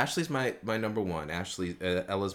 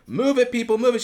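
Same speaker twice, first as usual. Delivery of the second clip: loud, high "move it"; teacher calling out; 13 semitones higher, 8 dB louder than usual. Retold or imitated. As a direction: herd the students along. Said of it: "she goes".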